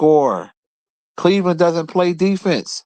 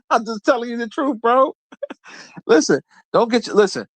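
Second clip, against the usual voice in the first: laughing; 7 semitones higher than usual. Real, not acted. Laughing while teasing rival fan.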